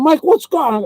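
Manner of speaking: high-pitched alarmed plea; retold panicky squeal for help; panicked mimicry. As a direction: plead as the trapped friend. Bitten friend begs Mike for help.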